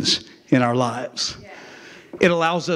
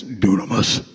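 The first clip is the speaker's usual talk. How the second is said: a big booming deep Dunamis; 6 semitones lower, slower than usual. Shown as a demonstration. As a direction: say Dunamis as low and deep as you can. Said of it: "Say it with your best deep voice".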